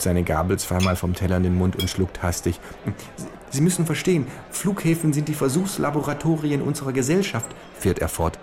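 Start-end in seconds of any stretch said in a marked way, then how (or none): 3.1-7.5 high falsetto of a small fat lobbyist. Acted. Lobbyist lecturing the kangaroo at the buffet, high falsetto. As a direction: squeak it out like a nervous little fat man. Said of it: "mit Fistelstimme".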